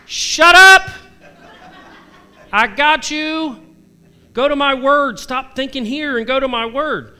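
booming, high, loud voice of God; God shouting down the overthinker; God's voice. Retold or imitated. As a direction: play God cutting off the worrier, laughing. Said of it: "And God's like".

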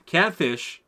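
usual speaking voice

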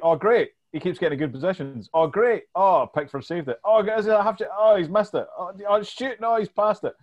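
rising excitement, watching a chance; fantasy manager reacting live to every chance; excited commentary. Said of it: "and I was like".

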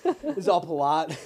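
said through laughter; slow, laughing remark on the polite caller. Real, not acted. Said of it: "laughing while describing".

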